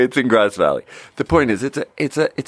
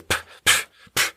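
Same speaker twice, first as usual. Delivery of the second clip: breathy scoffing pff sounds; dismissive puff; 3 dB quieter than usual. Retold or imitated. Rude customers scoffing at the bartender, dismissive puffs. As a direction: scoff with little puffs of air. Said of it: "they're going like this".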